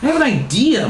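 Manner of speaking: high-pitched announcement; hammed-up eager reply; excited response. A narrator's reading. Margaret answering Charlie excitedly.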